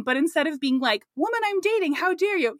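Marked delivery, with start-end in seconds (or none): 1.2-2.6 high-pitched mock indignation; indignant confrontation. Retelling Niles confronting his date, mock indignant. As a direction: imitate an outraged confrontation, pitched up. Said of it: "he confronts them".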